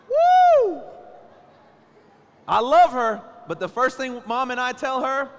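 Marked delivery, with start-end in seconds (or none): 0.1-0.8 loud, high whoop of disgust; loud, high-pitched comic woo. Real, not acted. Reacting to morning breath. 2.5-3.2 louder, higher I love her; loud, higher exclaim of love; loud declaration. Real, not acted. Shouting affection after joke.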